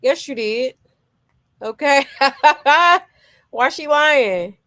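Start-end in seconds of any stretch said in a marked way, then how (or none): 2.1-3.0 a big loud real laugh. Real, not acted. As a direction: burst out laughing loudly. Laughs at the clip.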